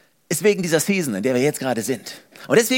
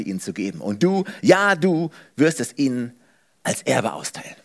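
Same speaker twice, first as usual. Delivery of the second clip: solemn scripture reading; 3 semitones lower than usual. A narrator's reading. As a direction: read it like a commission spoken over someone. Bible passage read aloud. (feierliche, langsame Bibellesung)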